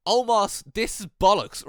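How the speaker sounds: loud, furious yell at his partner; angry outburst. Retold or imitated. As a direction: shout it like a betrayed teammate. Wrestler angrily confronting his partner.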